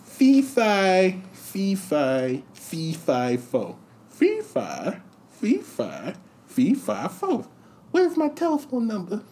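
high Tyson voice stumbling into a chant; Tyson impression, faltering. Tyson as Karnak fumbling the envelope in a high voice. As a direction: stammer it out in the Tyson voice. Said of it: "he holds the envelope".

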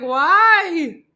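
high, loud retold "why"; mock tearful wail. Retelling crying over show clips, mock wail. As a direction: wail it like a comic sob. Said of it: "I'm crying my eyes out".

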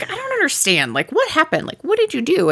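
lightly exasperated, high-pitched questioning; mock exasperation. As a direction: imitate a husband pleading for an explanation. Retold exasperated husband questioning wife.

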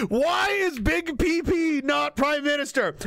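high, drawn-out mock wail; mock outrage. Supporter demanding Big P P as prime minister, mock outraged. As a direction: stretch it out in exaggerated protest.

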